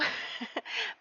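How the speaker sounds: short soft laugh; quiet, amused little chuckle. Real, not acted. Amused at own muddled sentence.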